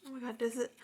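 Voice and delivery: usual speaking voice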